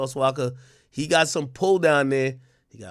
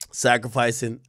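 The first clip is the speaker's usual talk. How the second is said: usual speaking voice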